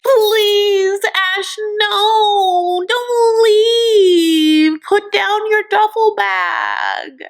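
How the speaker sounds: high, hammed-up begging woman; mock pleading. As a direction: melodramatic mock plea, slowing at the end. Mocking Avery begging him to stay.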